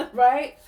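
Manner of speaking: short high burst of laughter, then a laughing "right?"; amused laugh. Real, not acted. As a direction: laugh it off at your own joke. Laughs at wanting to punch someone.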